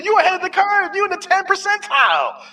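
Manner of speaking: very high, loud hammed-up protest; mock incredulous ranting. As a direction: plead at the top of a high voice. Acts someone urging him, incredulous.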